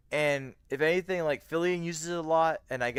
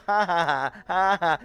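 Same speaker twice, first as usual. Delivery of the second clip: laughing; 5 semitones higher, 9 dB louder than usual. Real, not acted. Laughing at chat.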